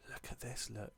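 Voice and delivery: soft whispered question to himself. Real, not acted. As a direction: whisper it as if puzzling over the screen. Whispering while figuring out menu.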